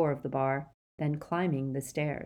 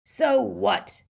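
loud, higher-pitched angry bellow; 5 semitones higher, 5 dB louder than usual. A narrator's reading. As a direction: bellow it into their faces. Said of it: "he was bellowing".

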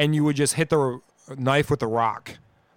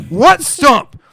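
high, very loud baffled comeback; retold yelled retort; 11 semitones higher, 10 dB louder than usual. Driver acts out questioning the passenger, loud disbelief. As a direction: fire back in disbelief.

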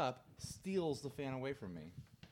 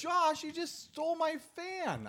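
much higher, slow, put-on complaint; mock outrage; 4 dB louder, slower than usual. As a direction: whine it like a wronged friend. Retelling a fan being stolen.

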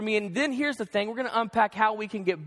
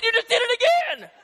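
much higher, louder, laughing; laughing accusation; 12 semitones higher, 4 dB louder than usual. Retold or imitated. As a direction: catch her in the act, amused. He catches her again.

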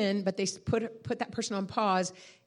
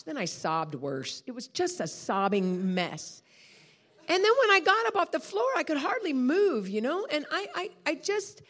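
usual speaking voice

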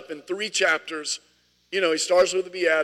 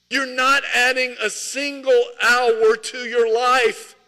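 urgent plea; 5 semitones higher, 5 dB louder, slower than usual. Retold or imitated. Jesus urging hearers stop worrying.

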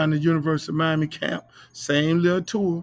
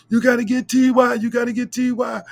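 raised, high insistent yelling; retold scream; 7 semitones higher, 5 dB louder than usual. Urging the coaches to sign T Y, loud insistent yell. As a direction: push it hard, high and loud. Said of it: "I kept screaming".

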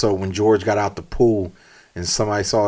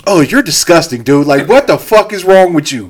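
loud, high mock berating; disgusted self-scolding; 7 semitones higher, 8 dB louder than usual. Berating himself in the car mirror, loud disgusted self-scolding. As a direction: lay into yourself in mock horror.

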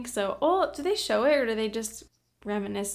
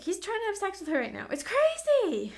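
excited; 9 semitones higher than usual. Real, not acted. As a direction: gush about the scene. Excited about favorite scene.

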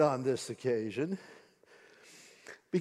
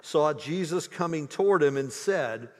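ordinary talk

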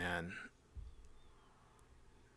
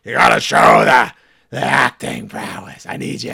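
mock tough-guy rant; 6 dB louder than usual. Ranting at Matt Damon in character.

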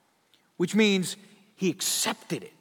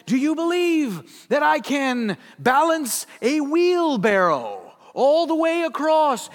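louder and higher, slow and grand; showman calling out; 8 semitones higher, 8 dB louder, slower than usual. Retold or imitated. Blondin challenging the crowd, booming showman. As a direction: stretch it out like a barker.

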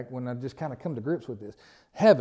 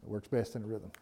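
softer repeat; 5 semitones lower, 10 dB quieter than usual. Shown as a demonstration. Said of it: "repeated softly".

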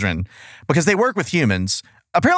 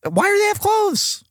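exasperated high squeak. Real, not acted. Exasperated rhetorical question.

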